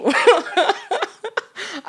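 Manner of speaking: long, high-pitched laugh; real laughter. Real, not acted. Laughing about her mouthy family, high laugh. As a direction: crack up, high and giggly.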